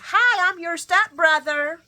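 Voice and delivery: loud, high, drawn-out introduction; hammed-up big reveal; mock announcement. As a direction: blurt it out big, mocking a grand reveal. Imagines Cody announcing himself.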